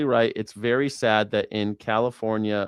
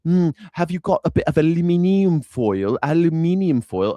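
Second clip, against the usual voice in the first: louder mock British "aluminium" with a rising lilt; mock British request; 4 semitones higher, 4 dB louder than usual. British visitor asking Americans for foil, mock British accent. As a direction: ask for "aluminium foil" like a polite Brit. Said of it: "If a British person came over and was like".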